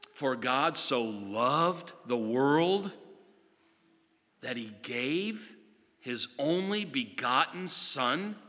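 slow, measured scripture reading; reverent recitation. Acted. Preacher reciting John three sixteen to the congregation, slow and reverent. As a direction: savour each phrase.

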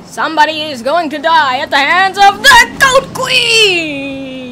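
loud, high villain threat; megalomaniac rant. Pretend. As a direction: menace them as the Goat Queen. Goat queen threat.

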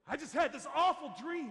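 loud, high, upset wife's complaint; angry wife. Retold or imitated. Wife comes downstairs after an awful dream, angry. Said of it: "she was angry at me".

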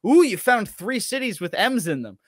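normal talking voice

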